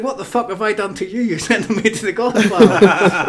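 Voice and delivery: mock outrage. Shocked at being sent to Godfather.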